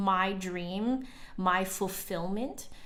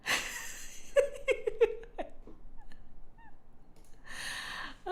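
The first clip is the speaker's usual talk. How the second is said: high laugh; 6 dB quieter than usual. Real, not acted. Laughing reading chat.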